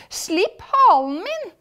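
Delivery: high, slow, loud protest; protesting cry. A narrator's reading. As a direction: demand release in a pained shout. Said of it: "roper Hokus".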